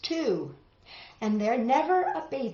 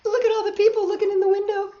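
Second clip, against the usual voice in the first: excited; 11 semitones higher, 5 dB louder, much faster than usual. Real, not acted. Pointing out picture details excitedly.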